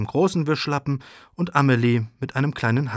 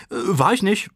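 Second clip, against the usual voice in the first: higher-pitched, hesitant little denial; trying to placate; 8 semitones higher than usual. A narrator's reading. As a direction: deny it to calm mother down. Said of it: "versucht Amelie Mama zu beruhigen". (beschwichtigend, mit hoher Kinderstimme)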